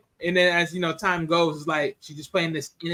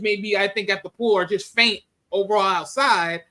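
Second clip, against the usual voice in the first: usual speaking voice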